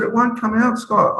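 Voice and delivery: high, fretful mock husband; worried husband voice. Husband scrubbing his skin in the shower, high mock fret.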